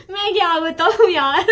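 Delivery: high, loud laughing speech. Real, not acted. Vlogger cracking up as she tries to explain, laughing. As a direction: burst out laughing through the words. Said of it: "क्यों हंस रहे हो?".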